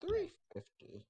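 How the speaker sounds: high-pitched slow bid; high bidder voice. Acted. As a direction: squeak out the bid. Rival bidder at auction.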